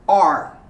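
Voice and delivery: "Our" as a short R sound; r-colored our. Shown as a demonstration. Teacher modelling "Our" for learners. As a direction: drill it, "Our" without the O U. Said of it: "You do completely without the O U".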